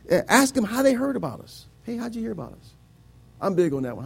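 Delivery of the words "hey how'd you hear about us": casual friendly question. Acted. Models friendly question to a newcomer.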